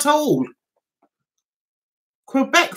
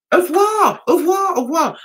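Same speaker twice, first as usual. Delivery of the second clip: high excited au revoir; shop woman calling goodbye; 7 semitones higher, faster than usual. Retold or imitated. Shopkeeper calling goodbye, high and excited. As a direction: sing it out cheerfully at the door. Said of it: "And she was like".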